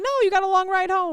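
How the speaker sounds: high-pitched retold reassurance. Retold host's reassuring reply.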